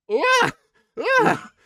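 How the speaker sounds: loud high battle cry; roaring yell. Acted. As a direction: bellow it at the monster. Infernal yell at monster.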